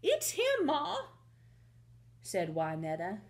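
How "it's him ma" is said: high-pitched, thrilled daughter; eager, high young cowgirl voice; excited cowgirl. Acted. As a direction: pipe up excitedly to your ma. Wynetta confirms the find to Ma.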